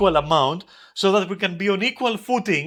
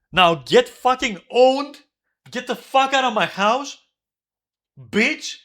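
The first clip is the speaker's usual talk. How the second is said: higher, louder, slow furious yell; real rage, every word hammered; angry shout; 5 semitones higher, 4 dB louder, slower than usual. Real, not acted. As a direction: bark him out of the house. Telling roommate to get out.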